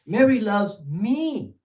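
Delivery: "me" held long and high at the end; stress on me. Shown as a demonstration. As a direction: build up to a drawn-out, high "me". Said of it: "Same words, completely different nuance".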